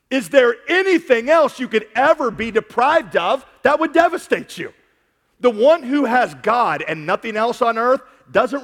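high, loud preacher's climax; full-force preaching. Acted. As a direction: raise the roof with the question.

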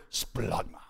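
"splagma" said like a retching sound; onomatopoeic pronunciation. Shown as a demonstration. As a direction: make "splagma" sound like what it means. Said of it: "I say it like that on purpose".

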